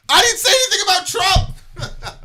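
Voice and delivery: high, loud, laughing indignation; exasperated outburst. Real, not acted. Answering people, loud and laughing. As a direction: blurt it out in disbelief.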